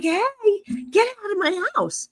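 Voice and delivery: high-pitched retold command; mock indignant call; indignant order.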